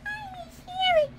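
very high squeaky whine; crying toy bear. Pretend. Toy bear missing someone, high pretend cry. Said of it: "Now we got Bear".

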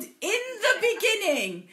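dramatic. Acted. Host presenting a story starter to the group, dramatic. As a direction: draw it out like a grand story opening. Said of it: "this is very dramatic".

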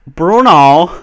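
put-on loud, high accented name call; Bruno. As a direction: belt out the name like a catchphrase. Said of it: "go on the mic and just be like".

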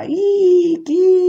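high, loud birdlike "Ih, chi?"; bird call. Retold or imitated. Prisoner mocking the police through the window, high bird cry. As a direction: shriek like a night bird. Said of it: "Gridai come un uccello, con una voce acuta".

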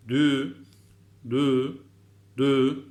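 "Dü" loud, lips pushed forward; dü sound. Shown as a demonstration. Teacher calling out "Dü" with rounded lips. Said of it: "dudağımız ileride olacak".

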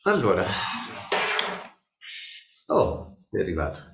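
ordinary talk, nothing marked

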